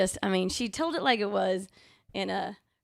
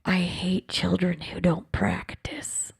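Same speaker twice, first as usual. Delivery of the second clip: slow grandmotherly whisper; much slower than usual. Retold or imitated. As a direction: whisper it slowly into a child's ear. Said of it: "she'd whisper in my ear".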